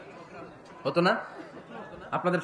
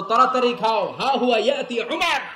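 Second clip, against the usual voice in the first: mock mother's warning; 5 semitones higher than usual. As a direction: play a mother warning that someone is coming. Mothers scaring children with Umar.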